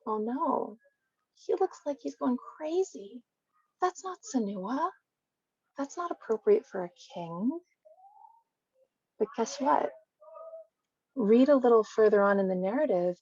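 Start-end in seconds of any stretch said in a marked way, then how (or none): 0.0-7.6 a hint of a shocked wife, higher and softer; lightly voiced wife fretting he looks crazy; disapproving wife. Wife fretting as King David dances, higher and softer. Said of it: "his own wife, Michal, thought".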